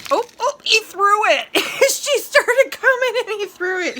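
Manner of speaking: high, loud, laughing exclamation; amused outburst. Real, not acted. Owner watching a bird throw food at another, real laughing outburst. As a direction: burst out laughing, voice shooting up loud.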